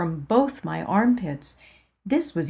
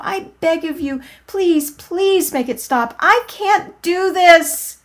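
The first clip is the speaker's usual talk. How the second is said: high, rising panicked begging; desperate pleading; 8 semitones higher, 4 dB louder than usual. Acted. Narrator begging God not to make her stand, building panic. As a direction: plead harder and harder until breaking. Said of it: "I inwardly cried".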